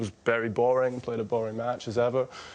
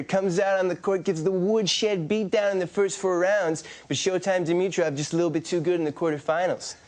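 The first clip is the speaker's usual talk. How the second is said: higher, hyped commentator voice; mock hype with nicknames, raised pitch; Brad Gilbert impression; 5 semitones higher than usual. Impersonator doing Brad Gilbert on request, raised hyped commentary. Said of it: "do you have a Brad Gilbert".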